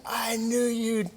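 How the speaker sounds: weary drill sergeant. Retold or imitated. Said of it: "and he said".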